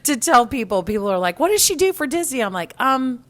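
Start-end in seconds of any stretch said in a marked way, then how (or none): 1.4-2.4 high, quick mimicked question; higher-pitched quoted question, rattled off quickly; mimicked questioner. Acting out curious people's questions. 2.8-3.2 high-pitched tentative answer; hammed-up clueless reply, high-voiced; mock uncertainty. Acting out own clueless answer.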